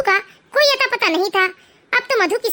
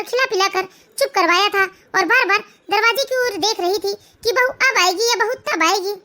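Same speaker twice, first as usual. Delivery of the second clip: ordinary delivery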